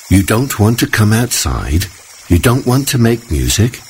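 a hint of a stern mother; scolding. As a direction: tell him off, disbelieving. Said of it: "his mother scolded".

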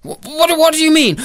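high, stuttering fright; alarmed husband. Acted. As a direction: stammer it in rising alarm.

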